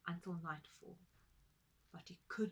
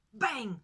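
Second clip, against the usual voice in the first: loud bang; 12 dB louder than usual. A narrator's reading. Sound effect called out.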